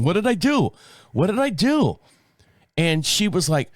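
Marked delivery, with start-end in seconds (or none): 0.0-2.0 panicked surprise. Retold or imitated. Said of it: "My first reaction".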